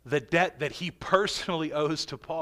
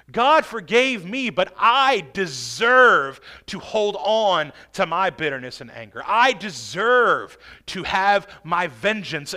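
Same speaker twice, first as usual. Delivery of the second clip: loud, slow preacher acting out a grudge; defiant entitlement; 7 dB louder than usual. Unforgiving believer justifies holding on to bitterness, loudly defiant. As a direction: hammer out each claim.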